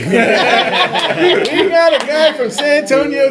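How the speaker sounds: hyped host. Retold or imitated. Emcee jumping straight from grief to hyping the comedy, laughing. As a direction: flip into a giddy show host. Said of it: "host after the tribute".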